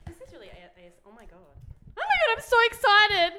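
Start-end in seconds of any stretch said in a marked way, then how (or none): 2.0-3.3 high, loud, thrilled squeal; excited squeal. Real, not acted. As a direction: squeal with delight. Opening the gift box.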